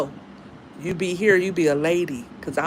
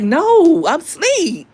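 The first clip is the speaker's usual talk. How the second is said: high, loud, drawn-out mock refusal; acted protest; 10 semitones higher, 8 dB louder, much slower than usual. Woman refusing her man's late-night request, high mock protest. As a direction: play up the protest, pitched way up.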